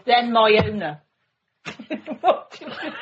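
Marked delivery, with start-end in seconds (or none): 1.6-3.0 giggling. Real, not acted. Giggling at own written line.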